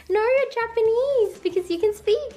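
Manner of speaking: put-on insistent little boy. Little boy contradicting his teacher, high insistent imitation. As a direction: imitate a child insisting, pitched right up. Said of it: "the little boy".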